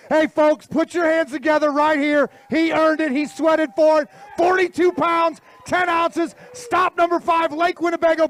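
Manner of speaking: high, projected emcee call; announcer crowning the champion. Acted. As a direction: present the winner to a cheering crowd. Presents the champion to crowd.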